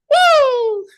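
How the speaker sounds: high, loud whoop in reply; answering war cry. Retold or imitated. Colleague mimics the response to the war cry, high loud whoop. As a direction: mimic a long answering "woo". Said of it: "then I hear the response".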